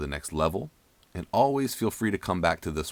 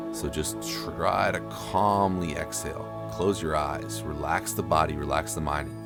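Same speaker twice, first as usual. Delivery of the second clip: guided breathing; 3 dB quieter than usual. Acted. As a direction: ease them through each breath. Guides breaths in ice bath.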